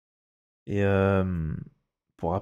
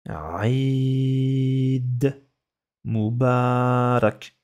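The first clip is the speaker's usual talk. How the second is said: Eid Mubarak drawn out very slowly for pupils to write; stretched dictation voice; much slower than usual. Shown as a demonstration. Dictating words to a class.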